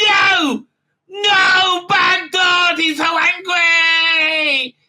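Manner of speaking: shrieking high and loud, hammed-up; mock high shout. Recapper mimicking the doctor scolding the robot, shrieking. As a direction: yell it like a squeaky cartoon tantrum. Mocking the doctor yelling at dot.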